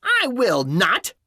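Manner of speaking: high, slow, stubborn witch voice; drawn-out witch retort; witch's retort. A narrator's reading. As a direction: refuse slowly in a high witchy voice. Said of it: "retorted the witch".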